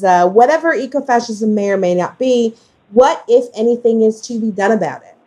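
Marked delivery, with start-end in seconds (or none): none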